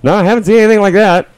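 very high, quick little crab voice. Acted. Game master as crab answering the druid, squeaky crab voice.

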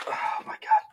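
winded, whispered gasp of words; breathless whisper. Real, not acted. After lifting heavy trophy.

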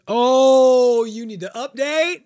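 hammed-up software nagging; mock update nag. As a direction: pester like an update pop-up. Mocking the software's update prompt.

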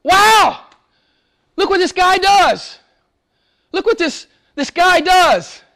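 high-pitched street-style "wow" of astonishment; amazed exclamation. Acted. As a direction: exclaim like an onlooker who can't believe it. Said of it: "the street equivalent of that day of".

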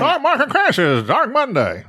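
high, slow headline cry; old-time newsboy. Acted. Host hawks crash headlines, newsboy calling voice. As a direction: call out headlines like a paperboy.

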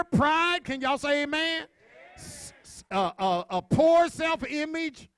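high-pitched sermon call, one item at a time; preaching a list. Real, not acted. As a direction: call each word out to the congregation, with pauses.

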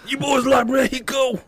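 high, loud unhinged trooper; crazy trooper voice. Retold or imitated. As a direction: squeal it like a crazed cop. Quoting crazy trooper from movie.

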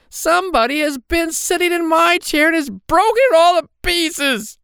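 very high, loud squeal of a tiny bear; shrill Baby Bear. A narrator's reading. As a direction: wail it shrilly as the outraged little cub. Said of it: "said Baby Bear in his little shrill voice".

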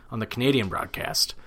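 ordinary talk, nothing marked

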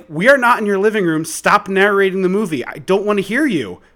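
retold angry reprimand; mad telling-off. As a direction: scold a noisy moviegoer, peaking on the order. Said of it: "I was so mad".

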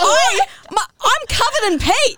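high indignant squeal; outraged disbelief. Real, not acted. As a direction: wail it in shocked disgust. Outraged retelling climax.

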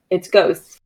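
low, eerie ghost call; ghostly voice. Pretend. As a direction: spook them with a ghost voice. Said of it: "Is it the time where I shout once again".

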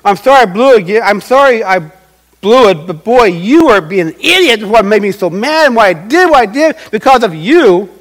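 loud, heated excuse-making; apologize, then pile the blame on them, loudly; hammed-up angry non-apology; blaming mock apology. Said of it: "if you're not giving an excuse".

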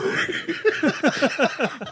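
high-pitched burst of laughter; high cackle. Real, not acted. Laughing before Arquette story.

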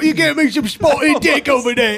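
bad British accent ending in a shout; shitty British accent. Co-host showing off a shitty accent, loud mock British. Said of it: "activate shitty British accents right now".

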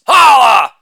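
loud exclaimed tag; big showy holler; shouted catchphrase. Acted. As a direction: belt it out as a punchline button. Shouted catchphrase after each bit.

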